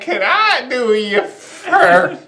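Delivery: slow high slurred barfly voice; town drunk. Acted. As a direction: drag it like a tipsy old-timer. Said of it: "there's one guy in the corner like".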